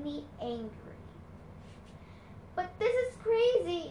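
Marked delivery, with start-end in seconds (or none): 2.5-3.9 higher, urgent protest; cried out. A narrator's reading. Annabeth protesting to Chiron, crying out in disbelief. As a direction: cry it out in disbelief. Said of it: "Annabeth cried".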